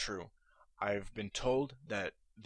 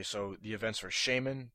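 ordinary talk

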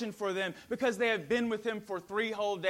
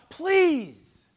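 pleading, much louder and higher; anguished prayer; 6 semitones higher, 8 dB louder than usual. Acted. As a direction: beg God in anguish. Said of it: "pouring out his heart to God".

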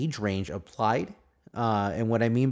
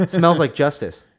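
said through a laugh; 5 semitones higher, 6 dB louder than usual. Real, not acted. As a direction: laugh out the punchline. Punchline, laughing.

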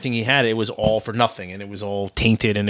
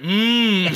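Frankenstein's creature hum; 9 semitones higher than usual. Retold or imitated. Retelling his belly rub at the muffin, monster-like hum. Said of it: "You sounded like Frankenstein's creature".

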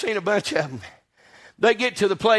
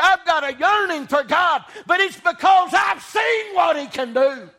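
fervent preaching; 7 semitones higher than usual. Acted. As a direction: preach it out with full force. Preacher building at full force.

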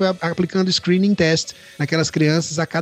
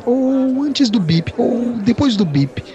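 slow, hesitant; 5 semitones higher, much slower than usual. Retold or imitated. Indecisive message mocked slowly.

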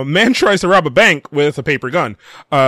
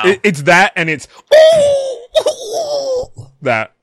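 Murloc voice; 6 dB louder than usual. Pretend. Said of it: "Is that a Murloc?".

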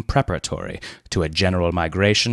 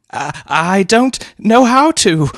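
higher, slow stammering fright; faltering; 13 semitones higher, 8 dB louder, slower than usual. A narrator's reading. Leonard helpless before the aunt, faltering scared. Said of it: "faltered Leonard".

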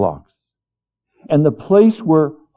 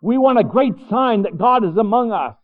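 pleading crowd; 9 semitones higher than usual. Acted. Voicing people wanting a sign.

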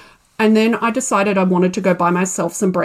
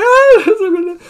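stunned oh; 11 semitones higher, 7 dB louder than usual. Retold or imitated. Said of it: "I'm like".

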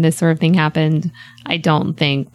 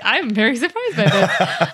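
mock surprised; 4 semitones higher than usual. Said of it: "I was like".

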